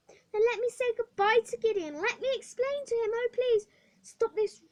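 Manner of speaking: put-on girl begging the matron. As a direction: beg for one last goodbye. Hetty begs to say goodbye.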